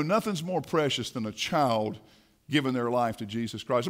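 ordinary delivery

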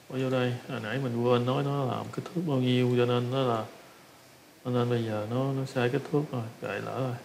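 ordinary talk, nothing marked

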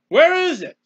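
loud, high-pitched demand; crying out. Acted. Preacher as sufferer asking God for his love, high loud cry.

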